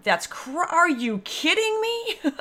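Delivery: high, slow, stretched-out disbelief; impatient disbelief. Retold or imitated. Reading a weight-loss post, high, slow impatient disbelief. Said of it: "impatient going".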